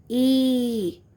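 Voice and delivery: ई pulled out long and steady; long sound. Shown as a demonstration. Teacher showing children the big letter's sound, long ई. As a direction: draw ई out slowly. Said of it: "इसे हमें खींच के बोलना होता है".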